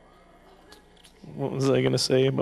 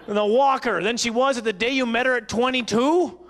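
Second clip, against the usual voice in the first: normal talking voice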